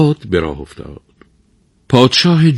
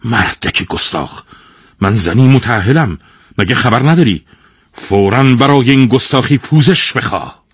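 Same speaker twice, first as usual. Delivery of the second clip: indignant rebuke, building louder and higher; angry lady; 4 semitones higher, 5 dB louder than usual. A narrator's reading. Married lady rebuking an insolent king, angry. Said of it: "با عصبانیت گفت".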